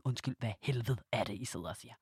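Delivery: held-back retort. Retold or imitated. Retelling her reply to the guests, low and soft. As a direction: mutter it back under your breath. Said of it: "så var jeg bare sådan".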